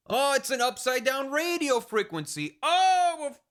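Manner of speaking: very high, gushing character voice; hammed-up high-pitched marvelling; mock delight.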